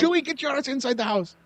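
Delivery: mock scolding aunt.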